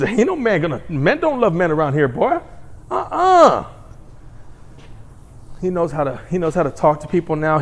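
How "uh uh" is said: sharp high uh-uh; high refusal. Acted. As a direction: squeal it like a firm no. Comic refusal.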